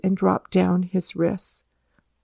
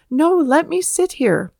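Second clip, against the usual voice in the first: higher, emphatic pleading objection; startled protest; 8 semitones higher, 4 dB louder than usual. A narrator's reading. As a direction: protest against the proposition. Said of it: "starting and protesting against the proposition".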